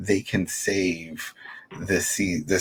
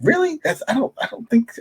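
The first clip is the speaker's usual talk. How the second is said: incredulous. Retold or imitated. Own incredulous reaction retold.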